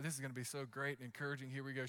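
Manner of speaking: quick, hushed, mock-hopeful aside; sarcastic inner thought. Son bracing for mom's praise, quick hushed sarcasm. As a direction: rattle it off softly, tongue in cheek. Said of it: "I'm like".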